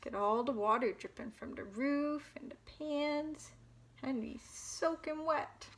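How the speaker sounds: usual speaking voice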